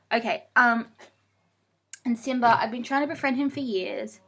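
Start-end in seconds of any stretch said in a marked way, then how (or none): none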